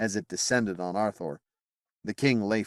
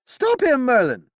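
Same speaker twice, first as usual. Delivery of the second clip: much higher and louder cry; desperate yell; 8 dB louder than usual. Acted. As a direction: scream for help. Knight yelling at the wizard.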